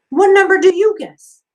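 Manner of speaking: put-on high, loud eager girl; eager question. A narrator's reading. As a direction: push the question out brightly. Myra asks Elia.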